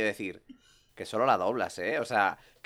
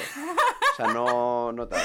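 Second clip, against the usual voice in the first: laughing; 5 dB louder, much slower than usual. Real, not acted. Laughs while denying.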